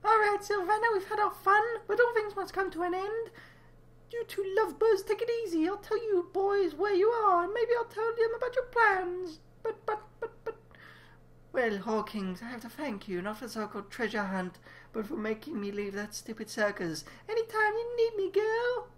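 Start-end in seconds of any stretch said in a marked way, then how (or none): 0.0-10.5 squeaky character voice. Acted. High squeaky character speaks. 17.3-18.9 squeaky character voice. Acted. High character voice.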